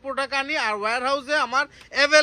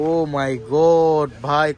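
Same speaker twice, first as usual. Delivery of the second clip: drawn-out excited; 7 semitones lower, 3 dB louder, much slower than usual. Real, not acted. Gushing over the pink colour.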